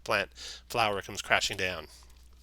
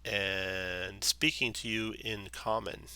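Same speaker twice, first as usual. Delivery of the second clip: normal talking voice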